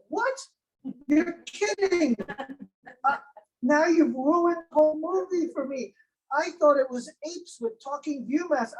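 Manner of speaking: high-pitched feigned shock; mock disbelief. Joker to a man correcting him about apes, mock disbelief. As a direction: play dumb and aghast, pitched well up.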